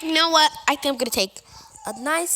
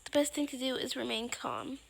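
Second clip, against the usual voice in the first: usual speaking voice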